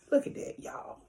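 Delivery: hushed whisper over the plate. Real, not acted. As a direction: whisper it like a secret treat. Showing off the food plate.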